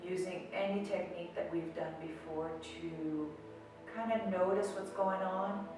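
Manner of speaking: soft, slow meditation voice with long pauses; guided meditation. Acted. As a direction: ease the listener into stillness, softly and slowly. Leads a seated meditation.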